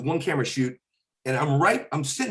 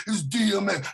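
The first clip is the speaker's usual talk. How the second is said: [name] impression; 7 semitones higher than usual. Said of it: "a radio intro".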